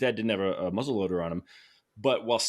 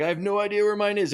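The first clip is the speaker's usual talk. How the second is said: high, louder retold holler; imitated screaming; 11 semitones higher, 5 dB louder than usual. Brother-in-law calling back through the woods, imitated screaming. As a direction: yell it high, as if to someone out of sight. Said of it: "screaming at each other through the woods".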